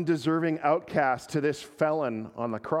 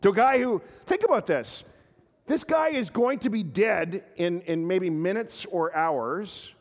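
higher and louder, building sermon climax; full-force preaching; 6 semitones higher, 4 dB louder than usual. Acted. Pastor urging the congregation about Jesus' welcome to outcasts, impassioned preaching. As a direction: preach it with mounting fervour to the congregation.

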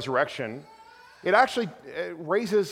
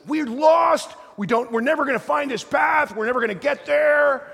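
high, loud mock despair; son's panicked wailing; 11 semitones higher, 5 dB louder than usual. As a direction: whine it out loud like a hopeless, complaining son. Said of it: "my one says".

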